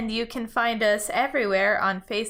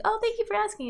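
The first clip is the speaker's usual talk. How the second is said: gushing thanks; 12 semitones higher, faster than usual. Retold or imitated. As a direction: put on an over-grateful little voice. Acted reply to baristas' question.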